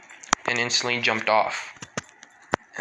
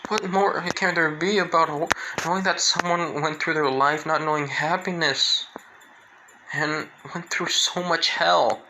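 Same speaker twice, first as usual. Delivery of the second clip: upset disbelief; 5 semitones higher than usual. Retold or imitated. Toga protesting that there can be more, higher anguished voice. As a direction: push it up in distressed disbelief.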